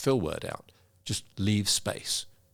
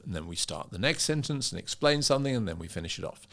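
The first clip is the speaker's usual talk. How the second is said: same predictable arc, fading down on "finish it off"; repeating sentence pattern; 5 dB quieter than usual. Shown as a demonstration. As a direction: lift "next sentence", let "finish it off" drop away. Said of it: "another pattern is to start the sentence".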